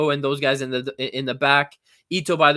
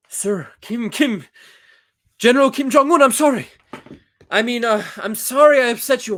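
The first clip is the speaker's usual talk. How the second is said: high, loud, hammed-up groveling; mock panicked apology; 7 semitones higher, 6 dB louder than usual. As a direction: beg forgiveness in a jokey panic.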